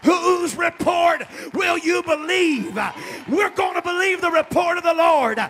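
shouted, driving sermon cadence; preacher urging the congregation to believe; full-force preaching. Acted. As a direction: hammer the message home. Preacher applies the message.

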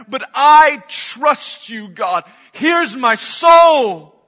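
high, loud, slow cry of surrender; impassioned prayer. Acted. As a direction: cry it out to God with everything. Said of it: "to hear David say".

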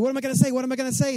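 flustered, what do I do; nervous panic. Retold or imitated. Zacchaeus panicking as Jesus nears, high and fretful. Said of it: "you imagine Zacchaeus is like".

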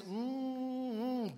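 drawn-out yummy hum; savouring mmm. Retold or imitated. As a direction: hum with delight at the taste. Savouring honey on toast.

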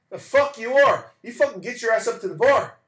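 pushy local yelling at the stranger to join the bar; mock shout. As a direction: bellow the order good-naturedly. Local orders him to the bar.

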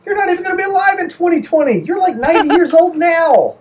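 high, fast disbelieving retort; incredulous. Retold or imitated. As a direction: scoff at him, pitched high. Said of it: "I'm like".

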